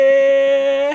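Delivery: long, high-pitched cheering cry. Real, not acted. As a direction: cheer along, stretching the word out. Host cheers caller's shouted greeting.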